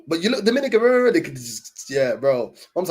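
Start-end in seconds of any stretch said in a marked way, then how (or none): 0.1-1.1 high, incredulous "but you look Dominican"; surprised disbelief. Retold or imitated. Surprised restaurant staff insisting the mum looks Dominican. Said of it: "they're like".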